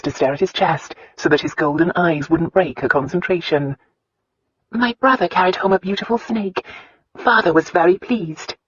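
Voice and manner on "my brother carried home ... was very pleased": put-on higher-pitched wife telling a childhood tale; earnest young wife recounting; Christina's voice. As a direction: lift the pitch, earnest storytelling heroine. Voices Christina telling her story.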